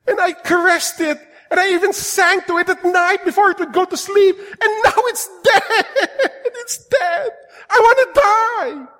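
high, loud, mock-sobbing wail over a lost tomato; hammed-up whining gardener. Preacher mocking a gardener mourning his tomato, mock sobbing wail. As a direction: wail it like a melodramatic gardener, half laughing. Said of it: "and you go".

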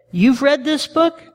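high, slow disbelieving "You've read this book?"; surprised question. Retold or imitated. Pastor asks the young woman, slow surprised disbelief. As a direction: stretch it out in mock astonishment.

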